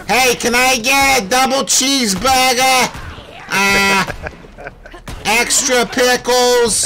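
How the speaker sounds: high, slow put-on ordering voice; drive-thru order voice. Shown as a demonstration. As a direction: draw out the order in a silly high voice. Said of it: "if I go through a drive-thru, I'm like".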